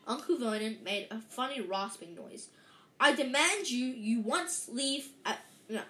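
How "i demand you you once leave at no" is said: louder, slow indignant demand; blustering demand. A narrator's reading. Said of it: "he said".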